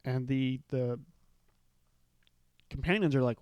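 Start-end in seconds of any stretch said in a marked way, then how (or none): none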